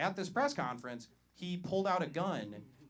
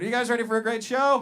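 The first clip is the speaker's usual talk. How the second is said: loud, higher hype-up to the crowd; hype shout; 8 semitones higher, 11 dB louder than usual. Real, not acted. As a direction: pump up the room. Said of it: "I need more than that".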